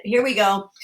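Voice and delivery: ordinary delivery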